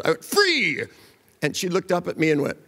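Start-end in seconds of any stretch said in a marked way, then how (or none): 0.2-0.8 high, drawn-out exclamation; sudden cry. Retold or imitated. Therapist startling a depressed client out of it, high sudden cry. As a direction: call it out like a sudden release.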